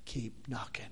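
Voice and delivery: soft and low. Real, not acted. Preacher closing the sermon, quiet and low. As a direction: let it sink in quietly. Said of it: "the conclusion".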